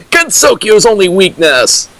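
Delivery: normal talking voice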